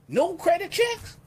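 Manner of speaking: high, squeaky mock-astonished voice; amazed disbelief. Pitchman to viewers, hammed-up high disbelief. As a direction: gasp it like you can't believe it.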